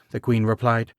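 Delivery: ordinary delivery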